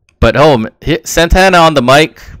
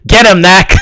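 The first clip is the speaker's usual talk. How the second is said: imitated wrestler's shout, higher and louder; mock yell; 5 semitones higher, 5 dB louder than usual. Podcaster recapping Kenny urging his goon on, mock yell. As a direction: yell it as a retold battle cry. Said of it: "and Kenny yells".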